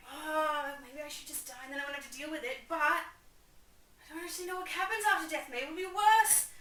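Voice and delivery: high, melodramatic Hamlet fretting; mock anguish. Hamlet dithering about death, in mock anguish. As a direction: build to a loud, high-pitched mock panic.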